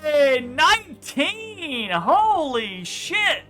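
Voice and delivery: ordinary delivery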